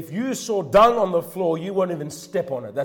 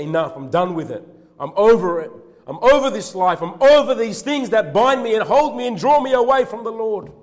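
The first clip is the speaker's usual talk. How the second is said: rising from firm to high and loud; building preached vow; 7 semitones higher, 8 dB louder than usual. Acted. Quoted vow of renunciation.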